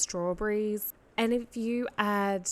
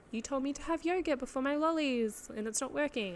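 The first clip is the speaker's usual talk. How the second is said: higher-pitched mock whine; mock complaint; 6 semitones higher than usual. Listener complaining that the yogurt advice failed, mock whine.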